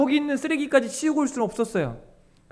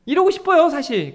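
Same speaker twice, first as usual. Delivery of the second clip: usual speaking voice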